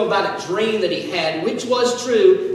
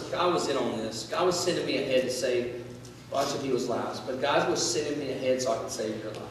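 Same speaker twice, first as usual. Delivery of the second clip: much softer, hushed reassurance; quiet sincere comfort; tender hush; 8 semitones lower, 11 dB quieter than usual. Retold or imitated. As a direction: speak it gently, almost confiding. Joseph comforts his brothers.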